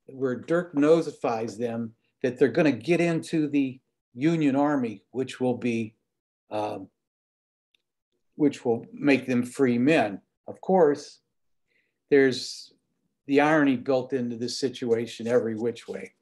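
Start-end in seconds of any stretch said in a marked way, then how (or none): none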